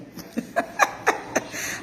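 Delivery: quiet, voiceless laugh. Real, not acted. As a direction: chuckle softly for a moment. Laughs while reading viewer comments.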